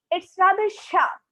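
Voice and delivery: a hint of grim sharpness. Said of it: "said Miss Meadows grimly".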